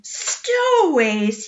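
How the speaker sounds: slow, loud, high furious roar; captain exploding at news of stowaways. A narrator's reading. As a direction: explode with the word. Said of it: "he roared".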